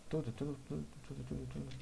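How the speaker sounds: repeated, dying away to nothing; fading echo. Acted.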